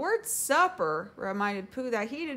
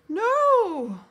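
high, loud, stretched shout; alarmed cry; 13 semitones higher, 12 dB louder than usual. A narrator's reading. Piglet stopping Pooh opening the door, alarmed cry. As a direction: cry out to stop someone. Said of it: "cried Piglet".

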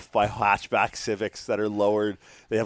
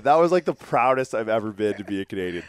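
normal talking voice

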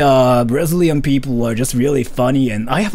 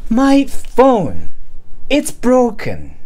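high, slow, insistent Karen complaint; demanding voice; 10 semitones higher, slower than usual. Shown as a demonstration. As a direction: whine and demand, pitched high. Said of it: "in a demanding voice?".

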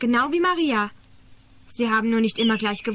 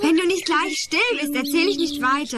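raised, higher scolding voice; annoyed threat; 6 semitones higher than usual. Acted. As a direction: warn the noisy child sharply. Storyteller scolds the singing child.

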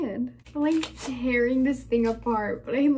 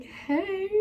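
much higher chirpy hey; mock vlogger greeting; 9 semitones higher than usual. Vlogger miming filming herself, cheerful high greeting. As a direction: greet the camera playfully. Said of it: "walking around like this, like".

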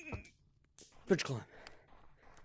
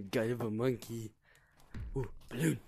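ordinary talk

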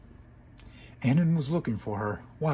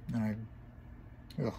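ordinary delivery

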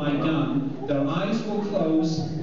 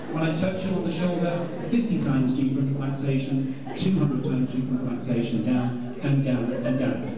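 low, rhythmic hypnotist patter; hypnotic induction voice; 4 semitones lower than usual. Acted. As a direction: lull them down into trance. Hypnotist deepens trance.

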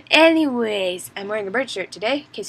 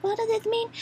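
confused squeak; 12 semitones higher, 4 dB quieter than usual. Retold or imitated. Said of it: "And I'm like".

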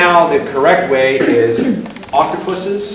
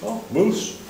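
higher, softer Oh of understanding; lightly voiced settler finally getting it; mild realisation; 8 semitones higher, 8 dB quieter than usual. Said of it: "understanding settler".

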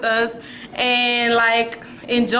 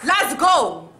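high-pitched hype shout-out; loud rallying call; 6 semitones higher, much slower than usual. Pretend. Rousing the vlog's followers, loud and high. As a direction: call the whole crowd to its feet. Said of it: "Why are you so loud?".

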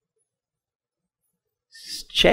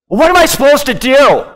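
loud, high, helpless protest; acted cry of bewilderment, quick and high; exasperated plea; 8 semitones higher, 6 dB louder, faster than usual. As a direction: throw the question out in frustration. Acts out a frustrated believer's question.

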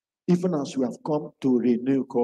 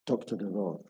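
quiet prayer invitation; 9 semitones lower, 8 dB quieter than usual. Shown as a demonstration.

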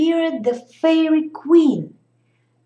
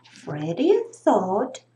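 ordinary delivery

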